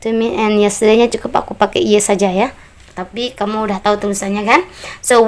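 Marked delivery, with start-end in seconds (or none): none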